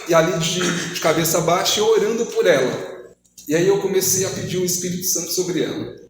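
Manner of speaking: normal talking voice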